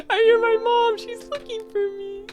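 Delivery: very high, choked-up acted voice; tearful joy. Character hearing mom through the portal, tearful and high. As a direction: squeak it out close to happy tears. Character hears mother calling.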